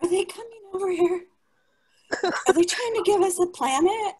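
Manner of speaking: high, fluttery mock panic; mock alarmed meek. Meek people panicking about inheriting earth, high mock alarm. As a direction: play the meek as nervous, squeaky. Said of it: "The meek are like".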